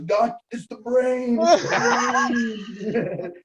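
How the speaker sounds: high, slow, laughing taunt; mocking Chris voice. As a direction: crow it out like a giggling prankster.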